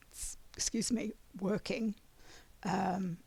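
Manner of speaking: ordinary talk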